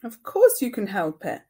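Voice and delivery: loud, firm scolding. Acted. Mother scolding her little son, loud and firm. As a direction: tell him off sharply. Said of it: "Mum scolded".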